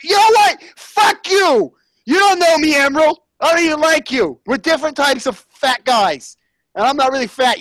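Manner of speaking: loud, high-pitched comic rant; angry outburst. Acted. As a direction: blow up at a rival chef, high and loud. Acted furious outburst at Emeril.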